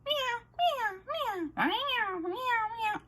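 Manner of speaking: high, squeaky imitation of a whiny cat; annoying cat imitation; cat meows. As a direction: mimic an insistent cat meowing on and on. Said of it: "if you've ever heard cats meow".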